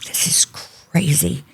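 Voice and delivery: slow, whispered disbelief; retold stunned whisper. Woman recalling pain in the store, stunned whisper. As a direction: breathe it out slowly, barely voiced. Aside to herself during pain.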